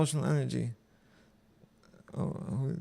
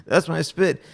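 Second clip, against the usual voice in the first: louder, mildly alarmed exclamation; horrified, excited; 3 semitones higher, 9 dB louder, slower than usual. A narrator's reading. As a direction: blurt it out with a touch of horror. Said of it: "I horrified excitedly".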